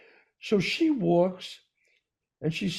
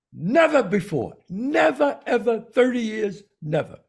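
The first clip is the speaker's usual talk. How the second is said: never pitched up high and loud with a laugh; emphatic never; 6 semitones higher, 4 dB louder than usual. Real, not acted. Telling the host about thirty years at the back, amused and emphatic. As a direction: stress it in amused disbelief. Insisting they never sit there.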